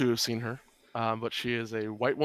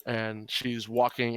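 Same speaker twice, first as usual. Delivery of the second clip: normal talking voice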